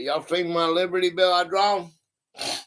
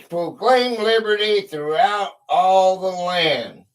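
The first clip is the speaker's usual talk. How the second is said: loud, slow proclamation, pitched up; reading aloud; 3 semitones higher, 4 dB louder, slower than usual. Real, not acted. Host reads the bell's text to live viewers, loud read-aloud. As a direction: read it out to the whole audience.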